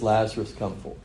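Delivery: normal talking voice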